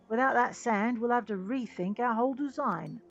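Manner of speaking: loud, pleading customer; frustrated engineer. Acted. Engineer begs merchant for the sand, loud and frustrated. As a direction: press hard for help with an exasperated push.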